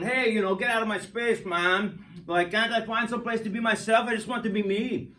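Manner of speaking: mildly voiced annoyed electron; grumpy electron. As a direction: grumble like a shoved kid in a crowd. Electrons voiced as jostling kids.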